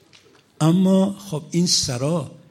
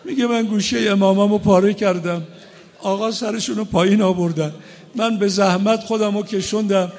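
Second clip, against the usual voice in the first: louder, higher preaching in a grieving build; impassioned mourning sermon; 7 semitones higher, 7 dB louder than usual. Acted. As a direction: push the lament toward the climax. Emotional rowzeh at rising force.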